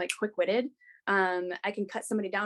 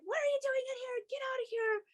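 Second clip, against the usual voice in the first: much higher, softer frightened demand; panicked, shrill-pitched but breathy; heightened response; 7 dB quieter than usual. Retold or imitated. Demanding the man leave, high frightened voice. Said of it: "that was my heightened response".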